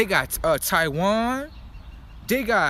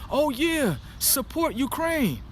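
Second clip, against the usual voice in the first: mocking naive supporter; 6 semitones higher, slower than usual. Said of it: "they got the American people thinking, like".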